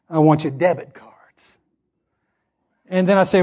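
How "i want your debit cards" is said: unvoiced, sly whispered demand; mock whisper. Henry the board member pressing for the debit cards in a whisper. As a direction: whisper it like a shakedown.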